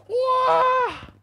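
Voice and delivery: very high drawn-out cry; shocked wail. Acted. Storyteller watches the axe head fly away, high shocked wail.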